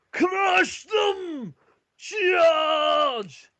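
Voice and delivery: very high, drawn-out war yell; dwarf warrior's full-force battle cry; battle-cry shout. Acted. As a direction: scream the charge to the whole army. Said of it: "Ulfric shouts".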